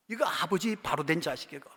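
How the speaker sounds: normal talking voice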